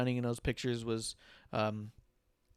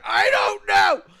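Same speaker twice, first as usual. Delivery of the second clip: loud, high, drawn-out shout; retold yelling back; 15 dB louder, much slower than usual. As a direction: re-enact yelling back in helpless exasperation, slowly. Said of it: "while she's yelling at me".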